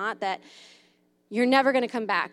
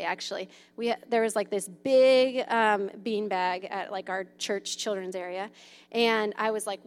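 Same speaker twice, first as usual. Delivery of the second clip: usual speaking voice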